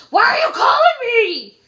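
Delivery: woken-up angry shout. Retold or imitated. Woken night worker yelling at the doctor's office. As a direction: bark at the caller who woke you.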